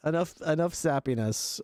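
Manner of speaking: usual speaking voice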